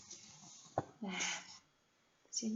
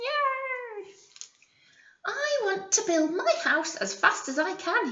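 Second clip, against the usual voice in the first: high, gleeful squeal of a piglet; excited little pig; 10 semitones higher than usual. Acted. Teacher voices the excited first pig for the class, high and gleeful. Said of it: "The first little pig was excited".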